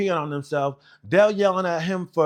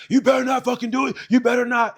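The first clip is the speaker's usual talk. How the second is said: high, fast instructor losing it; imitated frantic yelling; 9 semitones higher, 4 dB louder, faster than usual. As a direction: yell a panicked warning as the instructors. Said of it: "start to lose their mind".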